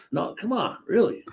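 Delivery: high, pleading disbelief; disbelieving. Retold or imitated. Reacting to the drunk wanting more.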